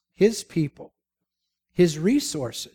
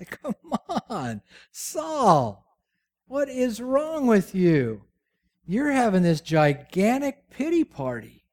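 exasperated scolding; 6 semitones higher, slower than usual. Real, not acted. Preacher scolds Saul, laughing.

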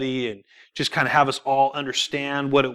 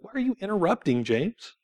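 softer quick reproachful question; a quiet nudge, not a scolding; soft gentle question; 4 semitones higher, 8 dB quieter than usual. Retold or imitated. Holy Spirit asking James why he interrupts, soft reproach. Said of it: "He's like".

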